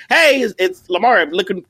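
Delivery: happy cheer. Retold or imitated. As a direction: chirp it like an eager kid asking for a job. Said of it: "happy, happy cheer".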